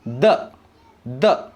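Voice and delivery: the sounded as a short voiced "duh"; teacher spacing out each the sound; second th sound. Shown as a demonstration. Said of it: "next sound that it gives".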